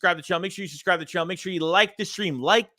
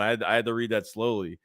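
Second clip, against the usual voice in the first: ordinary talk, nothing marked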